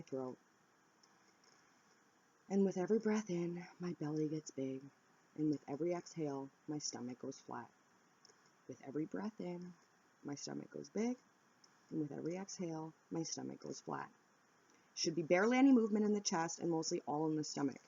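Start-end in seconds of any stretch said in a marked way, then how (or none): 2.5-14.1 soft, paced breathing instructions; soft breathing demo. Shown as a demonstration. Guiding viewers through belly breathing, softly. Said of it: "So I put one hand on my belly".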